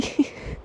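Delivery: quiet unvoiced chuckle; soft breathy laugh. Real, not acted. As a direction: laugh under your breath. Laughing at the misreading.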